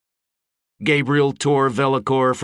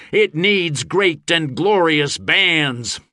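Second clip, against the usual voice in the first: slow, higher rallying yell; 7 semitones higher, 4 dB louder, slower than usual. A narrator's reading. Gabe over crash of sword and shield, yelling. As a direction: carry it over the clashing. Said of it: "he yelled over the percussive crash".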